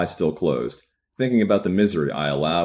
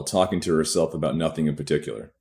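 ordinary talk

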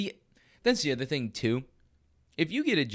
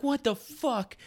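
much higher, slowed, squeaky "what the fuck"; a man learning he was fired; stunned disbelief; 13 semitones higher, slower than usual. Retold or imitated. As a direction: gasp it out in utter shock. Boss confirms he was fired.

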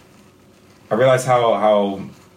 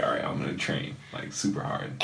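whispered resolve; 9 semitones lower, 11 dB quieter than usual. Retold or imitated. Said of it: "I was like".

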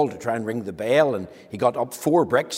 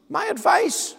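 surprised; slower than usual. Acted. Robinson surprised by the request.

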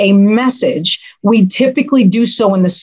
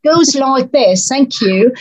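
higher-pitched, hammed-up British lilt; horrible British accent; 4 semitones higher than usual. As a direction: lecture in a badly faked British accent. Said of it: "speak with my horrible British accent".

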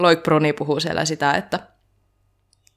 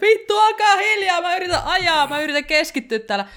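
high-pitched hammed-up plea for silence; mock exasperated yell. Distracted racer yelling at noisy trackside spectators, mock exasperated yell. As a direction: shout it in a high, strained mock outburst. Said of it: "mun teki mieli aina huutaa".

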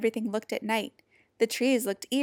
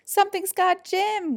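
high-pitched cry of alarm; alarmed scream; 10 semitones higher, 3 dB louder than usual. A narrator's reading. Warthog sees Jim grabbed, screaming in alarm. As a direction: raise the alarm, voice shooting up. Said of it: "Warthog screamed".

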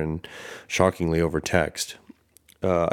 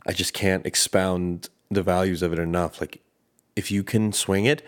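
ordinary delivery

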